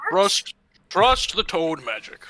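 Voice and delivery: slow, loud insistence; slow emphatic. Acted. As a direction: lean hard on trust. Bolg urging, slow and loud.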